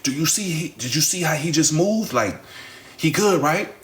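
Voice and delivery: high, anxious pleading voice; lightly voiced frightened girlfriend, begging for reassurance; worried plea. Maya asks Ray, scared, if he'll be okay: high anxious plea.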